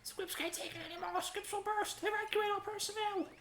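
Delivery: high squeaky creature calling an evacuation; squeaky alarm voice. Acted. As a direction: squeak out the evacuation like a cartoon critter. Voices a game character alarm.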